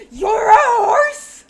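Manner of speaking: high, drawn-out full-volume yell; crazed accusing shout. Acted. Crazy horseman points at Thancred, yelling wildly. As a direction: holler the accusation, stretching it out.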